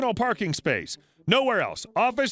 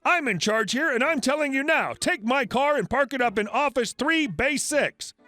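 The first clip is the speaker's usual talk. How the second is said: raised pitch, emphatic and bossy; angry ordering; 7 semitones higher than usual. A narrator's reading. Crazy lady orders valet to park her car, angry and bossy. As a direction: lay down the law, indignant. Furious customer cuts valet off.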